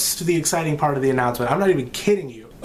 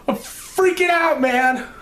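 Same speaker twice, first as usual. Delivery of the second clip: much higher, slower, squealing; freaking out; 13 semitones higher, slower than usual. Real, not acted. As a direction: let the excitement explode. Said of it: "That's how excited I am".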